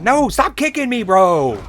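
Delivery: high, loud cry of protest at being kicked; exasperated protest. Real, not acted. Streamer yelps at the game for kicking him, high and loud. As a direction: yelp it out as the game kicks you again.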